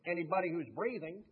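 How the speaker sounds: put-on booming Georgia accent, rising at the end. Speaker quoting the old Chick-fil-A founder, in a loud Georgia accent. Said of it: "that Georgia accent".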